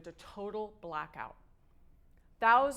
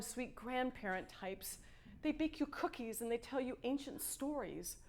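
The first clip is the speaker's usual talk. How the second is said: usual speaking voice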